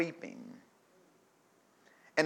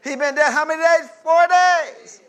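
higher and louder, incredulous; exclaimed; 9 semitones higher, 11 dB louder than usual. Real, not acted. Preacher stressing to the church how long Lazarus was dead. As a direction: shout it in disbelief.

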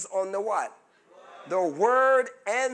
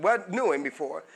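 normal talking voice

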